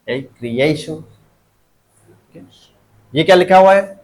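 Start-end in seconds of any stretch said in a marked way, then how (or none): 0.1-1.0 creation softer, with a long middle "AY"; stress on A. Shown as a demonstration. Teacher picks the syllable before last, creation stressing "AY". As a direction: say creation softly as "kree-AY-shun". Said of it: "उसके पहले वाला कौन सा".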